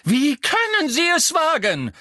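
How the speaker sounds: very high, loud cry of indignation; high-pitched furious challenge; outraged shout. A narrator's reading. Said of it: "rief die Gestalt im Laufen".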